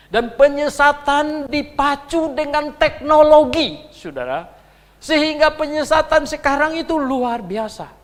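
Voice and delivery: loud, high sermon delivery; fiery preaching. Acted. Preacher warning congregation about online deception, fiery. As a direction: warn the flock with full force.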